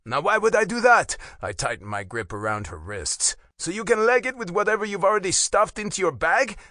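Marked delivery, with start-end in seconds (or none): 0.0-1.1 loud, high-pitched challenge; mocking, raised-voice refusal; taunting retort. A narrator's reading. Taunting the captive intruder. 3.6-6.6 loud, pitched-up accusation; indignant challenge with raised pitch; accusing. A narrator's reading. Accusing intruder of stealing.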